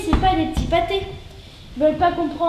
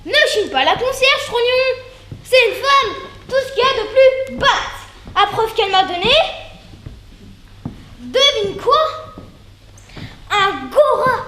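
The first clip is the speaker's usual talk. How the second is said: much higher and louder, slowed and laughing through the words; giddy comic character voice; 7 semitones higher, 5 dB louder than usual. Acted. Boasting to her partner about the concierge's gift, high excited voice. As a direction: gush like an overexcited gossip.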